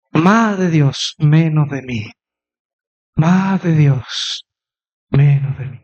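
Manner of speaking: very slow, measured phrases with pauses; slow contemplative prayer demonstration; breathing prayer. Shown as a demonstration. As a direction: let each phrase fall away like a breath. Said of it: "y respirar".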